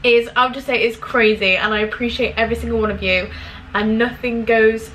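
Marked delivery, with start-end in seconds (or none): none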